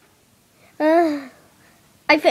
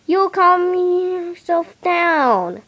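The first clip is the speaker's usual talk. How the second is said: ordinary talk, nothing marked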